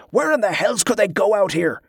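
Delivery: high-pitched indignant demand; outraged, incredulous officer. A narrator's reading. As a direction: demand an answer, exasperated. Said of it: "Ambrose demanded".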